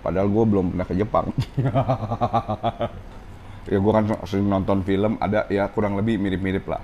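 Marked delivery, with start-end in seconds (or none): 1.3-3.6 long run of chuckles; amused, rolling laughter. Real, not acted. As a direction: laugh at your own admission. Laughs at own admission.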